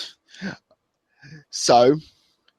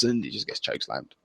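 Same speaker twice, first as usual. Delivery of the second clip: normal talking voice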